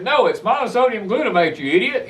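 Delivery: mock-scolding the company rep; exasperated retort. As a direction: retell an argument, pitch jumping up in exasperation. Retold argument with company rep.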